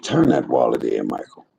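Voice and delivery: low, calm voice of God giving an order; lightly voiced God. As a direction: speak low as God telling him to return it. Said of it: "God said".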